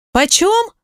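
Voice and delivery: high-pitched startled «Почём?»; surprised. A narrator's reading. Ирка по телефону не понимает подругу, удивлённо. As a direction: jump up in surprise on the word. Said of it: "удивилась моя подружка".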